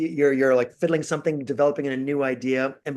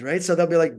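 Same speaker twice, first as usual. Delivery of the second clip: normal talking voice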